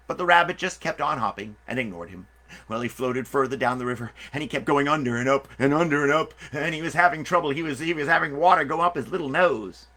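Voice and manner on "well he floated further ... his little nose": plain reading voice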